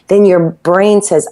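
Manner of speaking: ordinary talk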